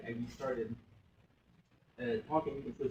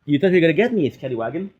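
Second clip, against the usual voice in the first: shouted mocking triumph; loud gloating taunt; 16 dB louder than usual. Real, not acted. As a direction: yell it in their face, gloating. Taunting the game loudly.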